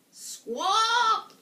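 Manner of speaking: loud, high bird-like squawk. Acted. Witch crashing down the chimney, squawking loudly. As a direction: let out a sudden loud squawk.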